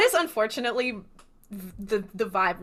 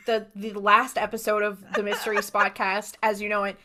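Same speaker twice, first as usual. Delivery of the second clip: ordinary talk, nothing marked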